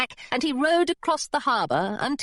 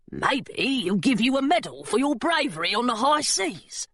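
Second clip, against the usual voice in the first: put-on gargoyle voice, swooping pitch; hopeful little creature voice with an altered timbre. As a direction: play the gargoyle with a lilting, singsong tune. Gargoyle speaks in story.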